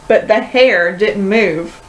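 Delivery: ordinary delivery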